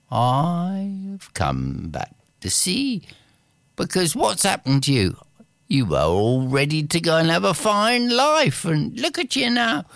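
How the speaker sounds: put-on high, exasperated old man; scolding old man. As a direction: nag him like a fussy old wizard.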